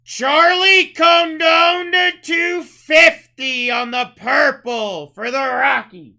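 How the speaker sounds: booming, slow, drawn-out call of the card name; hype announcer shout. Real, not acted. Breaker hyping a big pull to chat, booming drawn-out shout. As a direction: belt it out like a ring announcer.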